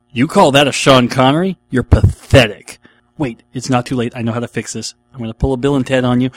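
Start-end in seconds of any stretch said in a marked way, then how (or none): 0.1-2.8 mock scornful put-down. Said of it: "to which the follow-up is".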